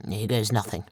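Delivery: low, soft mutter under the breath; grim low mutter to himself. A narrator's reading. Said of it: "Wayne muttered".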